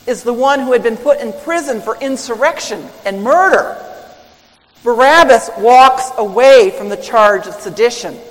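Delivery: ordinary talk, nothing marked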